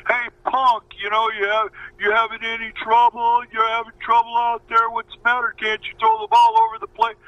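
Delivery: high, taunting jeers from the bench; mock the rookie pitcher like a razzing opponent, voice pitched well up; dugout heckling. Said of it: "calling me everything from".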